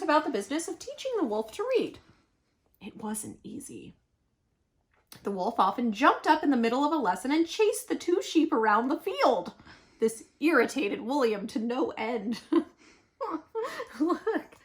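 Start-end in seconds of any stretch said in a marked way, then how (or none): none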